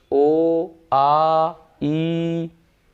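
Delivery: each vowel ओ आ ई उ held out long; demonstrating long vowels ओ आ ई उ held for two units; long vowel demo. Shown as a demonstration. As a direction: stretch every vowel ओ, आ, ई, उ to two beats. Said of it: "थोड़ा अधिक समय लग रहा है".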